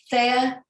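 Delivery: "Thea" said as THAY-uh; questioned pronunciation. Shown as a demonstration. Checking a participant's name, questioned pronunciation. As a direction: say "Thea" with an AY vowel. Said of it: "how you pronounce your name".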